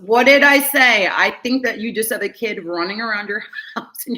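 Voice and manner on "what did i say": loud, high burst of told-you-so; pitched up and punched out loud; vindicated exclamation. Real, not acted. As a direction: exclaim it as if proven right. Card confirms what she predicted.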